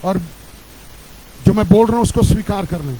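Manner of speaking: normal talking voice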